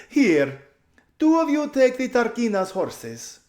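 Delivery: high, loud, slowly drawn out with a laugh; loud gushing drawl. A narrator's reading. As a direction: gush like a spoiled society lady. Lasaraline ordering slaves.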